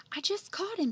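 high, excited announcement; crying out. Acted. Bill's mother showing Andy the frog, crying out excitedly. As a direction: burst out with the news. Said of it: "she cried".

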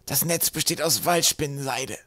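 high, slow, squashed delivery; stifled voice. A narrator's reading. Trapp explains the net from underneath, stifled voice. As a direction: strain it out slowly, pitched high. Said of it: "mit erstickter Stimme".

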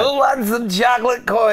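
high, loud hawking character voice; Hanukkah Harry. Acted. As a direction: call out a sketch-character impression. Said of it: "You sound like Hanukkah Harry".

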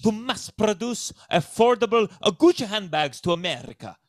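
hammed-up Italian patriarch accent; broad stage Italian accent; cartoonish Italian accent. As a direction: make a proud announcement in a broad fake Italian accent. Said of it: "speaks with an accent that".

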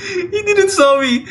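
very high, slow squeal through laughter; panicked relief. Real, not acted. Player to viewers after not being seen, squealing in relief. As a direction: squeal it out, half laughing, half crying.

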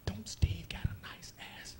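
whispered temptation from his own mind. Retold or imitated. Comic whispering the mind's sly voice in the jungle. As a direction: hiss it low like a sly inner voice.

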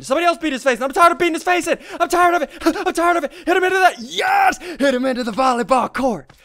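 high, loud excited hollering; high frantic gamer rant; worked-up ranting. Real, not acted. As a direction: yell it in the heat of battle. Worked up during boss fight.

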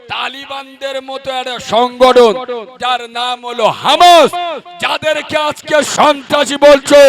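loud impassioned sermon, shouted peaks; fiery preaching. Acted. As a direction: preach at full force.

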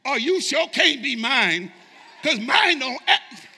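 laughing loud mock disowning; mock parental denial. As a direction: shout it through a laugh, pretending the child isn't yours. Parent disowning child to principal.

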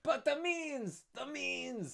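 very high, slow, drawn-out cry; Danglars voice. Acted. Danglars misread for Fernand, very high slow cry. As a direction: stretch each word out in a high squeal. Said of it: "that's Danglars".

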